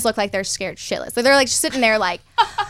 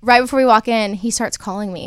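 normal talking voice

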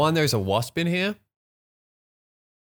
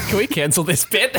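laughing. Real, not acted.

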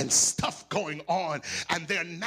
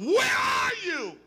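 high, slow shouted call; 13 semitones higher, 6 dB louder, slower than usual. Acted. God searching for his people, shouted slow call. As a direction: cry it out searching.

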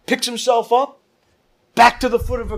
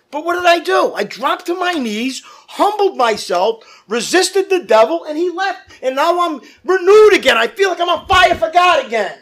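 higher, loud testimony, on fire; fiery preaching; 6 semitones higher, 3 dB louder than usual. Acted. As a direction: shout your renewal to the church. Testimony at full force.

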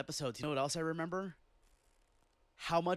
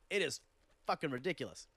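usual speaking voice

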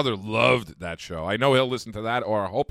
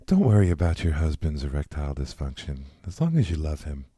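soothing radio advice voice; Delilah impression; 5 semitones lower, 4 dB louder than usual.

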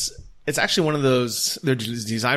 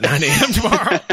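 laughing; 7 semitones higher, 6 dB louder than usual. Retold or imitated. Laughing while retelling a reply.

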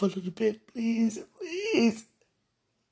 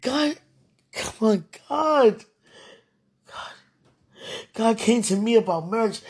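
ordinary talk

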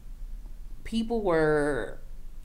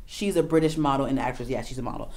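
ordinary talk, nothing marked

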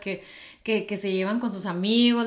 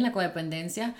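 ordinary delivery

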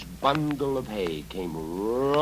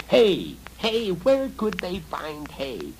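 goblin chatter; 8 semitones higher, 5 dB louder, slower than usual. Acted. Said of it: "goblins began to scream and chatter".

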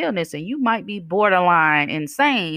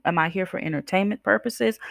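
usual speaking voice

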